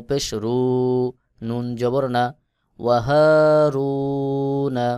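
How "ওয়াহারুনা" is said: slow, chanted recitation of ওয়াহারুন with a heavy র; Quran recitation. Shown as a demonstration. Teacher chants the word for students, slow recitation. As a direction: intone it slowly like tajweed practice.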